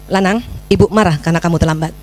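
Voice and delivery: low-pitched, restrained telling-off; controlled reprimand. Shown as a demonstration. Mother telling her late son off, low controlled reprimand. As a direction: reprove the child without raising your voice. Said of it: "kan itu ditegur".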